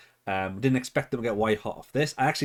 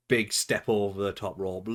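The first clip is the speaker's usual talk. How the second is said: ordinary talk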